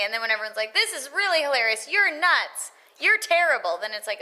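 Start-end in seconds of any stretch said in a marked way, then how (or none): 0.7-3.8 high, loud imitated hecklers; mock jeering. Actor imitating viewers laughing at her bloopers, mock jeering. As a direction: jeer in a hammed-up high voice. Acts out everyone mocking her outtakes.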